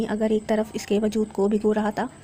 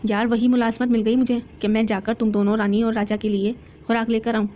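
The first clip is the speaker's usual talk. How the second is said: usual speaking voice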